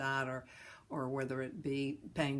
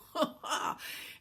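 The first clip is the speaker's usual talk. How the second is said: laugh; 12 semitones higher than usual. Real, not acted. Laughs at own joke.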